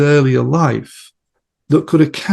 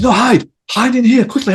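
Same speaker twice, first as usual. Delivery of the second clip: urgent high warning; 11 semitones higher, 4 dB louder than usual. Retold or imitated. Therapist urgently plays along in trance.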